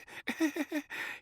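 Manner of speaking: high, nervous little giggle; nervous laugh. A narrator's reading. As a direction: laugh it off awkwardly.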